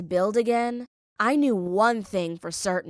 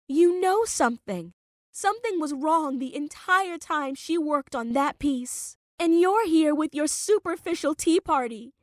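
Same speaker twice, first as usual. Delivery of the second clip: heated accusation; 7 semitones higher than usual. A narrator's reading. Olivia angrily accusing Vivian.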